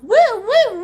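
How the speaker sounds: loud high woo-woo police siren; playful wailing siren noise; siren imitation.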